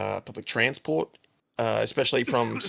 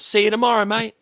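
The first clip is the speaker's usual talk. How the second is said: cheeky mate; 9 semitones higher, 5 dB louder than usual. Retold or imitated. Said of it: "all-time favorite pranks".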